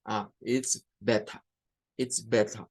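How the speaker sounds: "better" said "betta", ending in a schwa, no R; British non-rhotic. Shown as a demonstration. Teacher imitating a Briton saying "it's better". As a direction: end "better" on a soft uh with a clean T. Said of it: "Con el schwa al final".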